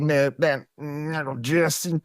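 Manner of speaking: a slow, mock Southern drawl on little Jesse; bad Southern accent. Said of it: "Your accents are terrible".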